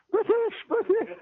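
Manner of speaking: high, indignant mock cry of British; hammed-up snobbish lady pushing to the front; squeaky, huffy imitation of an irate traveller.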